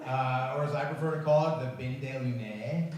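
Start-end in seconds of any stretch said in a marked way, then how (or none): 1.7-2.9 fake French. Shown as a demonstration. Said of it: "which is fake French for the loony bin".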